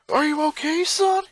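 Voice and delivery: high, loud, concerned father voice; lightly voiced worried father; worried dad. Father running up to his son after the attack, worried.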